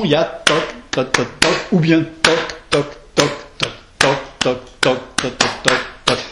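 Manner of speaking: "toc" long, then "toc, toc" short, a dactyl beat; tapping the rhythm. Shown as a demonstration. Teacher taps out the metre of the feet for the students. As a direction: knock out "toc, toc, toc" as one long and two shorts. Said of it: "Le petit groupe, ce qu'on appelle un pied".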